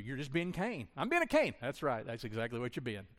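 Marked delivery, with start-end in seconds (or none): 1.0-1.5 high, loud sulky retort; mock whiny reply. Pastor mimics a sulky listener, high and whiny. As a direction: play the sulky sibling, pitched up and loud.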